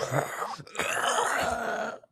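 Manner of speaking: high strangled groan; strained, choked-off squeal; strangled noise. Acted. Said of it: "make a strangled noise".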